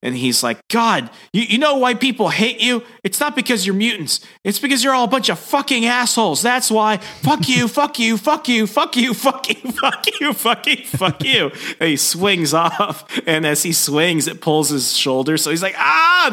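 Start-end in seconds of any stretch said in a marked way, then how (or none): 0.7-11.5 high-pitched rapid-fire cursing tirade; hammed-up outrage that keeps spilling out; mock furious rant. Peter raging at the X-Men before swinging off, mock furious rant. Acted angry rant at mutants. 15.8-16.3 very high-pitched cry of pain; hammed-up shriek at a wrenched shoulder; mock pained scream. Peter swinging off on his hurt shoulder, mock pained scream. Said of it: "because he's in pain".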